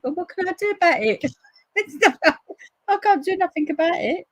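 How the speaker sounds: high, laughing shrug of resignation. Retold or imitated. Answering the neighbour, laughing and resigned. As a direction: laugh off the disaster. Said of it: "I went".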